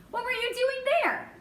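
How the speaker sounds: high-pitched, astonished question; surprised. A narrator's reading. Boy questions the mouse about the inn, surprised. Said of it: "Garf was surprised".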